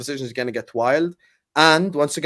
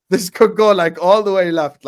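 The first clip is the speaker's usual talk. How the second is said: louder, rising, hyped up; hyped, loud warning about the collapse; excited warning; 3 semitones higher, 7 dB louder than usual. Real, not acted. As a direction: build the drama of it all going wrong. Building hype warning.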